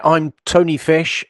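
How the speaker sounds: normal talking voice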